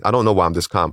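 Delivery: ordinary talk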